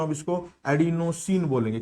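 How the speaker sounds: ordinary delivery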